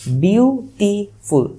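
বিউটিফুল very slow and high, in three drawn-out beats; syllable-by-syllable demonstration. Shown as a demonstration. Teacher showing students three syllables, বিউটিফুল broken slowly into three parts. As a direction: stretch out বিউ-টি-ফুল, each syllable apart. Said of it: "বিউটিফুল তিনবারই উচ্চারণ করতে পারতেছো".

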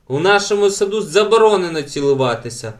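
high, loud outraged cry; shouting. A narrator's reading. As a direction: shout the ban across the garden. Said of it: "крикнула Елен".

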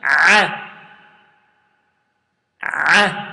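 a voiced as a squeezed throaty ayn; ayn sound. Shown as a demonstration. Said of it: "Repeat after me".

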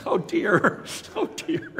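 high, laughing oh dear; amused oh dear. Real, not acted. Amused by the confessor's advice, a high laughing oh dear.

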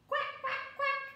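high quacking honks; duck quack. Acted. Duck answering the child, high quacks. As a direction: quack like a duckling. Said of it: "honked the duck".

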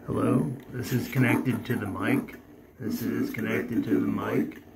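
electronically altered voice through the speakers; voice changer effect. Shown as a demonstration. Maker testing his mic through the voice changer. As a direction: test the mic through a real-time voice changer. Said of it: "have my voice altered in the speakers".

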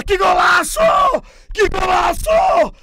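thrilled shouting goal call; explosive goal commentary. Real, not acted. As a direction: shout out the late winner in delight. Late winning goal call.

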